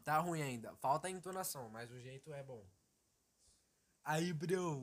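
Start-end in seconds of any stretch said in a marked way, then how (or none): 4.0-4.8 lightly voiced streamer greeting, a bit louder; a hint of João Frango's casual bro greeting; imitating João Frango. Showing the host his João Frango impression, lightly voiced. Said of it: "imitar o João Frango".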